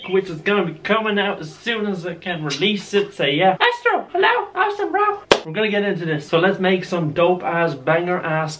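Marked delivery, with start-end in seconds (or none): 3.6-5.2 squeaky voice to the dog; high squeaky greeting to the dog; high pet-talk greeting. Acted.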